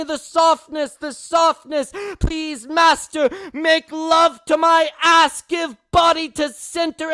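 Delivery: impassioned open-mic poem reading, swelling to shouts; spoken-word poet pleading, building to cries; poetry performance. Acted. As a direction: pour the poem out like a plea, rising and falling.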